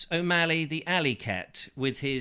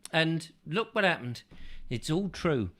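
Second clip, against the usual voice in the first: ordinary delivery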